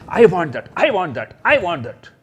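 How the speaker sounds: loud whiny I want that; demanding child. Retold or imitated. Teacher acting a child begging at the toy store, loud. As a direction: play the grabby kid who wants it now. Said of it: "jump up and say".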